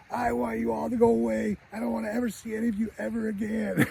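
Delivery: high-pitched mock tantrum of a little boy; angry kid. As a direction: wail it like a furious child, without real volume. Angry boy shouts back at mother.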